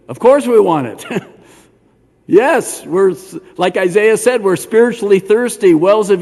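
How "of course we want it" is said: louder, eager reply from the crowd; the crowd answering Yeshua, eager and loud; crowd calling back. Retold or imitated. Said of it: "could hear him said".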